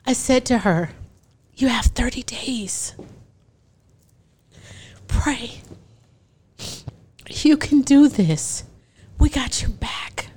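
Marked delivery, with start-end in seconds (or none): 1.5-10.3 slow, hushed reassurance. Retold or imitated. Adoptive mom whispers comfort to the birth mother.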